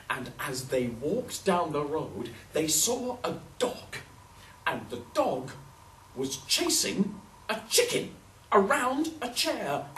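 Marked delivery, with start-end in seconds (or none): none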